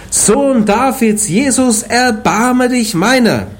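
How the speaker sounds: high, slow pleading cry. Acted. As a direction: cry out for mercy, drawn out. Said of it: "fing er an zu schreien".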